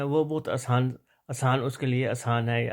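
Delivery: ordinary talk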